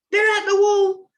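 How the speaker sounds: alarm shout. Acted. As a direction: raise the alarm in panic. Alarm raised in battle.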